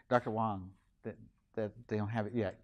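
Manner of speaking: low, soft aside to a colleague; hushed, low interjection; discreet heads-up. Acted. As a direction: tip off your co-teacher under your breath. Observer discreetly alerts co-teacher, acted.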